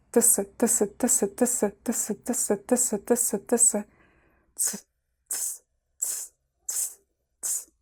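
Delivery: rapid soft ts, ts, ts; fuse t and s into a quick ts; fast ts drill. Shown as a demonstration. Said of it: "And even faster to combine them".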